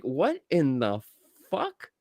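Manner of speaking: usual speaking voice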